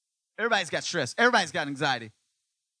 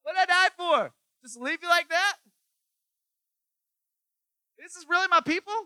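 much higher, loud dismay; exasperated Jesus; 14 semitones higher, 7 dB louder than usual. Retold or imitated. Pastor voicing Jesus dismayed at his people, high and loud. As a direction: plead like a let-down Jesus. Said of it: "When Jesus is going".

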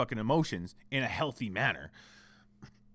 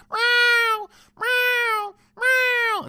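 piercing high imitated meows; loud, insistent mock meowing; loud cat yowl; 9 dB louder, much slower than usual. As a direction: mimic a needy cat yowling on repeat. Imitates the cat's nagging cries.

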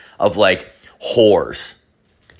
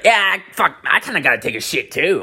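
high-pitched imitation of a buddy chiming in; lightly voiced eager coworker; coworker's reply; 7 semitones higher than usual. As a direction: act out his higher, keen agreement. Acts out coworker's excited reply.

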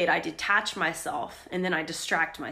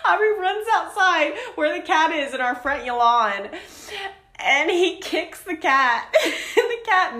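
laughing while telling; 10 semitones higher, 6 dB louder than usual. Real, not acted. Laughing through retelling the chaos.

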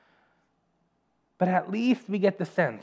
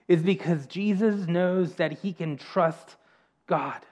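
normal talking voice

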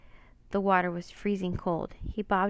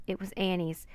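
plain reading voice

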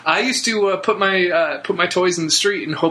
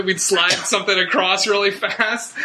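ordinary talk

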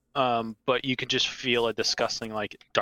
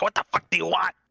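put-on annoyed old man; 7 semitones higher, faster than usual. DM voices old man again.

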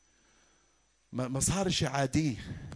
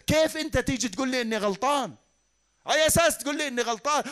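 offended outrage; 7 semitones higher, 4 dB louder than usual. Acted. Voices an offended ruler.

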